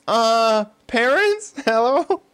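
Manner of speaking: put-on high, unsure child calling out; scared kid. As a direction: call for your parents like a kid. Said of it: "My ten-year-old reaction is".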